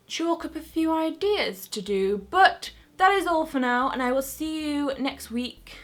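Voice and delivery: normal talking voice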